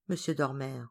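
usual speaking voice

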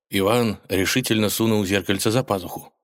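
plain reading voice

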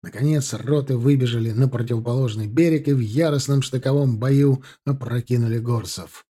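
usual speaking voice

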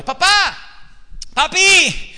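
put-on child calling out. Isaac called again, God urges.

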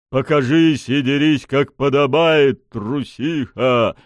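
loud, high, slow challenge; angry bark. A narrator's reading. Pushkin challenges the hidden lady to fight, barked. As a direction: dare the coward to fight. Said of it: "рявкнул Пушкин".

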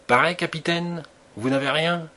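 lightly voiced gruff hail to the captain. A narrator's reading. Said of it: "demanda la voix bourrue".

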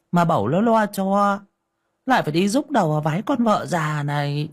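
high, shrill-pitched scolding wife; a wife needling her bedridden husband, high and scolding; nagging wife. Acted. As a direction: nag him like a fed-up old wife.

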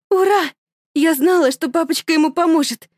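put-on high, loud childish joy; mother cheering like a girl; gleeful mother. (высоко, радостно)

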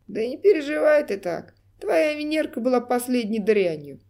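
loud, jeering taunt; mocking clown. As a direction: sneer it out, loud and cruel. Mocking clown taunts grieving man.

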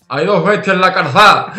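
loud, laughing Andalusian lisp on calzada; lisping Andalusian accent. Retold or imitated. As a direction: blurt it in a thick Andalusian lisp. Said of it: "Nota es bruto".

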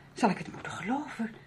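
soft, unvoiced, giving in; resigned whisper. Accepting the driver's grim news, she whispers. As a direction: breathe it out, defeated.

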